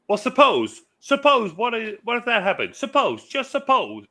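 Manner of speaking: very high, loud cartoon lech impression; Glenn Quagmire. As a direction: push the voice way up like the Family Guy character. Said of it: "it's like how Glenn Quagmire, Glenn Quagmire talks".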